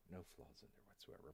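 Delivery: hushed, low whispered repeat; muttered echo. Pretend. YouTube bear repeating a mock disclaimer, muttered echo. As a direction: say it under the breath, very soft.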